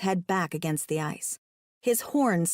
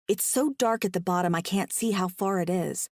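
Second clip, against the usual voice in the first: ordinary delivery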